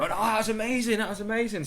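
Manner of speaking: high, gushing mock tourist voice. Tourist gushing about the sand dunes, high and excited. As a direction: squeal it like a thrilled tourist. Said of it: "and they go".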